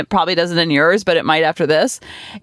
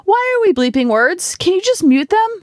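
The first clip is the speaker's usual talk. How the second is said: high-pitched, exasperated questions; exasperated venting; 10 semitones higher than usual. Real, not acted. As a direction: vent your pet peeve, pitch climbing. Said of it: "so a side vent".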